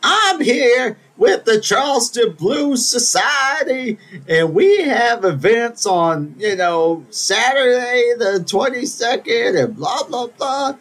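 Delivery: high, slow put-on voice of a woman plugging her society; imitating the lady. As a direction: ham up a pompous announcement. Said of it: "she goes".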